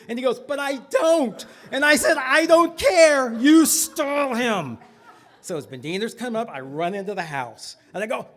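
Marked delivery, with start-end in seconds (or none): 0.4-1.4 high, slow mock protest; protesting kid. Little brother objecting to the plan, high protest. 2.2-4.8 loud, high, drawn-out command; insistent mock shout. Child ordering brother to stall the rabbi, loud insistence.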